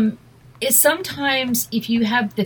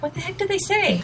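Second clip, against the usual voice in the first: higher-pitched mock bafflement; baffled reaction; 9 semitones higher than usual. Viewer puzzled by a British show, baffled and high. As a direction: act out being lost by the dialogue.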